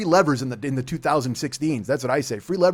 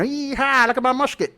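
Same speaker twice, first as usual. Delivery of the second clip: put-on old-time American yokel accent; 7 semitones higher than usual. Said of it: "that was America's accent back in the twenties".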